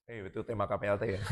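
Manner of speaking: ordinary talk, nothing marked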